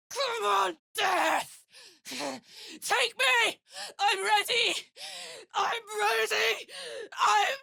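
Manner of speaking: shouting challenge to Death; defiant battle shout. Acted. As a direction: scream it at the enemy, ready to die. Battle cry daring death.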